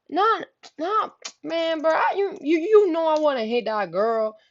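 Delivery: high-pitched, stammering plea; mock-panicked protest; nervous denial. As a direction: imitate a man squirming out of blame. Boyfriend pleads innocence.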